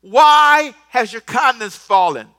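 slow, loud, pleading question; God pleading loudly. Retold or imitated. God loudly pressing sulking Cain, slow pleading. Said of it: "then he says a second question".

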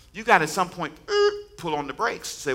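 ordinary talk

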